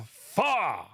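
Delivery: high, loud yelped "Fa"; loud meme shout. Pretend. Fan asked for the famous meme, belted out loud. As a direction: blurt the meme as a squawk. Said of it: "I think I did it worse".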